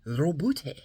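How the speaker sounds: Robute, trilled R again; rolled R. Shown as a demonstration. Vlogger repeating the name, rolled R. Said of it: "you gotta roll the R".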